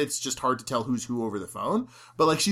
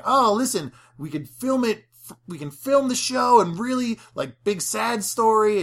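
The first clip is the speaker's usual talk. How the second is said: lightly voiced eager TV host, higher; breezy, salesy impression of the pitch; eager pitch for a sad story; imitating Ed's pitch; 6 semitones higher than usual. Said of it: "'Cause Ed is saying".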